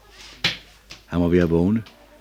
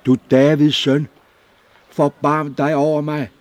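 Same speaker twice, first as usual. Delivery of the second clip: higher, loud plea; crying out; 8 semitones higher, 9 dB louder than usual. Acted. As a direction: call out to him across the crowd. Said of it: "sprang op og råbte".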